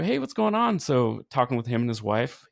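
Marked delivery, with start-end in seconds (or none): 0.0-0.8 much higher, lively retold hello; imitated upbeat hey, lifted high; retold surprised greeting. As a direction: pitch the greeting way up as if spotting an old friend. Retold greeting to old customer.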